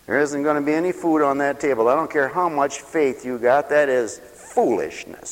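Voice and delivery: usual speaking voice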